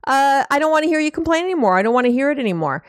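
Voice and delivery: a mild mock irritated dismissal; fed-up snap. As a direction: rattle it off fast, done with them. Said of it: "you say things like".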